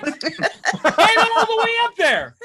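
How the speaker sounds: incredulous repeat. Retold or imitated. Repeating doctor in disbelief.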